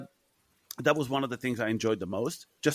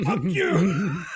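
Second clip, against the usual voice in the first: laughing through the shout; 4 semitones higher, 4 dB louder than usual. Pretend. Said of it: "I'm gonna go".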